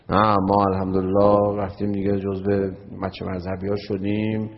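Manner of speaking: put-on thick, low voice; imitating a pompous thick-voiced boaster; mock self-important believer. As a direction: puff up the voice, low and thick, like a proud devotee. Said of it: "ببین صدات رو بیار پایین، صدات هم اونقدر کلوف نکن".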